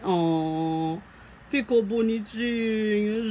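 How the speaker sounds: usual speaking voice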